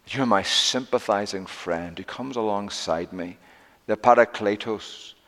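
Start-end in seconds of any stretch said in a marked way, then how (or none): none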